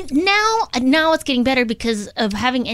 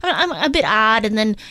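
normal talking voice

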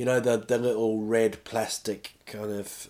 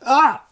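sudden high, loud "ah"; mock yelp of pain; 13 semitones higher, 9 dB louder than usual. Testing how sharp the knife is, mock yelp. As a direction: yelp as if cut by a blade. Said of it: "that kind of sharp".

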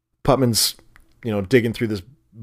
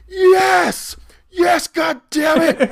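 high, loud exasperated outburst; mock freak-out yell; 5 dB louder, much slower than usual. Coconut Pete exploding at the cook's guess, imitated high yell. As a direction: imitate him losing it, pitched way up. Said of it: "still freaking out".

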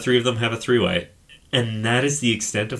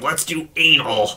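mock dumb guy; 4 semitones higher, slower than usual. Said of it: "he's just like".

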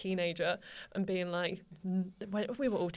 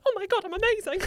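high, loud mock-gushing realisation; amazed delight; 10 semitones higher, 5 dB louder than usual. Teenager discovering she believes her own compliment, amazed delight. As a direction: burst out with giddy self-praise. Acting out sudden self-belief.

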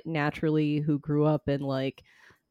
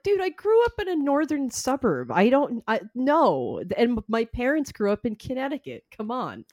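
higher, exasperated pushback; exasperated protest; 6 semitones higher than usual. Retold or imitated. Defending himself to disappointed people, exasperated. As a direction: plead your case, pitched up.

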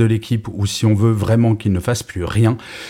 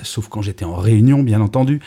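ordinary delivery

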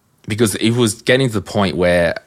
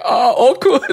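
much higher, laughing oh awkward; 4 dB louder than usual. Retold or imitated. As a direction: squeal it through a laugh at the awkward moment. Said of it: "looked at each other like".

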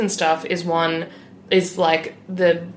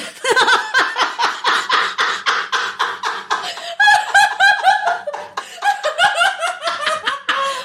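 loud laughing fit; 4 dB louder than usual. Real, not acted. Hysterical laughter at "divvy.

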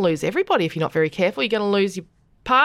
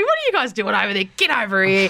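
calling out; faster than usual. Pretend. Calling out to New Zealand.

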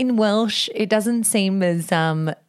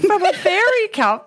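puzzled question; 10 semitones higher than usual. Real, not acted. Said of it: "No, for the fairy cup".

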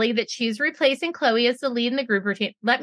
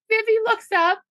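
usual speaking voice

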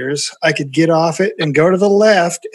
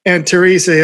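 ordinary delivery

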